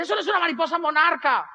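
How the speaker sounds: high-pitched mock-outraged mother; indignant protest. Mother protests the painted butterfly. (protesta de mamá indignada)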